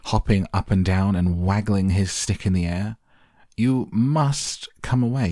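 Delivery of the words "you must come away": high, urgent order; agitated cry. A narrator's reading. Factory owner ordering boy from chocolate river, high and agitated. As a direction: order him away, pitched high. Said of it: "cried Mister Wonka".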